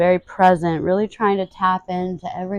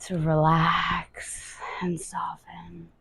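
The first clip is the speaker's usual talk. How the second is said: slow, hushed whisper; soft release; 3 semitones lower, 8 dB quieter, slower than usual. Shown as a demonstration. Guide allows the body to relax, slow whisper.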